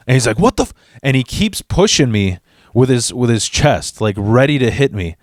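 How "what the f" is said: high, cut-off outburst; mock fury; sputtered rage. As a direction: choke on the anger.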